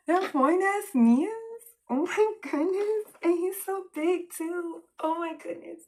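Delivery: high, drawn-out swoon; swooning gush. Acted. Swooning over an attractive man.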